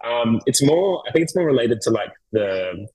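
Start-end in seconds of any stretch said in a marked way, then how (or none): none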